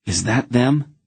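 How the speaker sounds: put-on a nervous boy's sudden question; shrill and afraid. A narrator's reading. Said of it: "his voice sounding shrill in his own ears".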